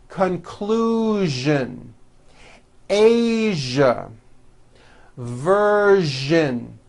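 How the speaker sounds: each word said slowly with a clear voiced zh; teacher modelling words to repeat; zh word drill. Shown as a demonstration. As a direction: stress the buzzing zh in every word. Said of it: "let's practice some more words".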